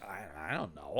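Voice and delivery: low, soft unsure mumble; sheepish mumble. Retold or imitated. Henchman answering his uncle, sheepish low mumble. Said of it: "And he's like".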